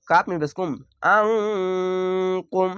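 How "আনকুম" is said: আনকুম with a thick আইন squeezed in the throat, nasal hold on ন; heavy throaty ain. Shown as a demonstration. Teacher demonstrating ikhfa and ain to students. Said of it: "আইনটিকে মোটা করে গলা চিপে পড়বেন".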